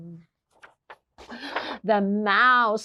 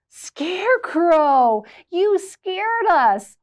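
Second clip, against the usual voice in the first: worried call; 8 semitones higher, 6 dB louder, much slower than usual. Acted. Animals cry out to scarecrow.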